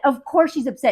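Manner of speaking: ordinary delivery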